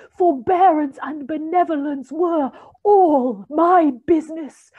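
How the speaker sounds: high, loud wailing spirit; Marley's ghost demanding belief from Scrooge; ghostly voice. A narrator's reading. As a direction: ring it out like a tormented ghost. The ghost speaks.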